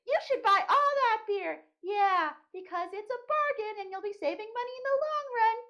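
high, squealing cutesy cheerleader for bad ideas; bad idea bear. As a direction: egg them on in a squeaky puppet voice. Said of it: "I would be the bad idea bears".

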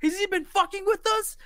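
high, loud, climbing in shock; can't believe the con man is a psychic; stunned disbelief. Retold or imitated. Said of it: "He's like".

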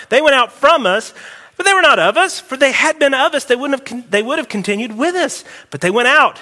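reading scripture aloud with rising fervour; scripture read with mounting intensity. Acted. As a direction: read the verses like a preacher building up.

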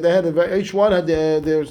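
normal talking voice

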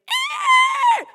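piercing high imitated animal shriek; sudden shrill mock animal scream; rat screech. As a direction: let out a blood-curdling squeal like a bitten rat. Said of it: "it let out this blood-curdling screech".

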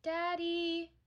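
put-on distant child calling; from another room. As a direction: call out from the next room. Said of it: "a small voice from the other room".

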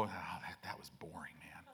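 whispering the complaint behind the pastor's back; hushed imitation of a bored churchgoer; mock whisper. Churchgoer complains to a friend about the sermon, whispering. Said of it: "And then they'll go".

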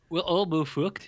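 tentative Irish accent, questioning. Shown as a demonstration. Co-host checking his attempt, tentative Irish accent. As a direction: try an Irish accent, unsure of it. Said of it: "you just say it".

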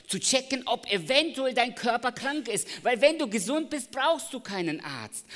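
loud, high preaching voice; fervent pulpit voice; full-force preaching. Acted. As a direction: preach it out to the congregation.

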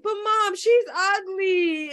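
put-on high, loud, drawn-out child's protest; whining little boy. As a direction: imitate a little boy about to bawl. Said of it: "the little boy was like".

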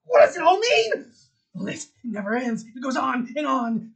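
loud, high, grand building announcement; energetic proclamation. Acted. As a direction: proclaim the names like an epic finale. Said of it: "This guy has lots of energy".

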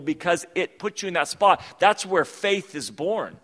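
loud, high preaching push. Acted. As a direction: drive it home to the congregation. Preaching at full force.

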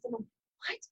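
ordinary talk, nothing marked

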